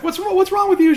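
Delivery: very high-pitched, hammed-up confused questions; mock confusion. Imitating Elsie confronting the shaking Bernard, high mock confusion. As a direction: squeal it as a confused woman. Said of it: "she's so confused".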